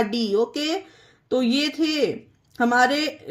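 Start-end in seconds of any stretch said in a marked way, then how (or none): 0.0-0.3 "डी" with a long ee, pitched lower; wrong vowel. Shown as a demonstration. Teacher showing what would be wrong, "डी" with ee. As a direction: drop lower on "डी", said with ee. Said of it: "ये पढ़ना गलत होगा".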